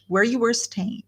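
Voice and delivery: usual speaking voice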